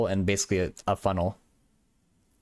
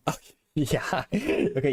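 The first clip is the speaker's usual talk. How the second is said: laughing; 6 semitones higher, slower than usual. Real, not acted. Reacting to an ironic chat question.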